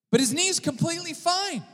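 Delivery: very high, loud, emphatic; high exclamation. Real, not acted. Preacher insists brother's knee was healed, high and excited.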